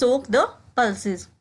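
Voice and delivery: slow, careful drill phrase; slowed classroom demo; slow model repetition. Shown as a demonstration. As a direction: say it slowly for learners to copy. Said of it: "इसको हम English में कहेंगे".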